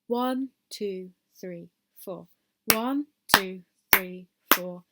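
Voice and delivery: loud count-in for the class. Shown as a demonstration. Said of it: "I'll count us in".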